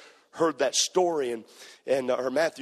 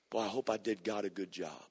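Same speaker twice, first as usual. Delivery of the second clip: quiet self-doubt; 7 semitones lower, 10 dB quieter than usual. Retold or imitated. Preacher's private worried thought.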